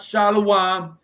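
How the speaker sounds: shalom drawn out as "sha-lo-wahm"; wrong pronunciation. Shown as a demonstration. Correcting a viewer on Hebrew, mispronounced shalom as "sha-lo-wahm". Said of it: "it's not shalom".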